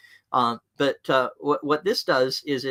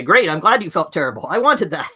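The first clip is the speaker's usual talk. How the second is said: high, louder sarcastic jab; mocking retort; 4 semitones higher, 7 dB louder than usual. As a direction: sneer it back like a smug coworker. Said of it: "They'll just say".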